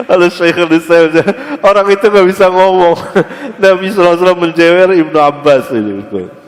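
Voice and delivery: high, loud and quick, bubbling with laughter; gleeful punchline. Real, not acted. Preacher delighting the audience with the comeback, excited and laughing. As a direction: crow the winning answer, laughing.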